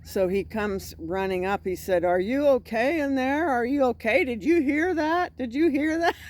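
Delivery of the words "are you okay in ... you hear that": high, urgent mock questions; raised-pitch, comic retelling of his alarm; imitated alarmed questioning. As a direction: imitate a startled man checking on someone. Imitates man running up after scream.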